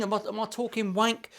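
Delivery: normal talking voice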